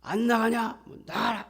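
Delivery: loud, high-pitched yelled command; sudden hammed-up shout, high and forceful; mock exorcism shouting. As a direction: imitate someone yelling at a demon to get out. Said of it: "소리를 질러가면서".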